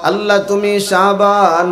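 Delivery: normal talking voice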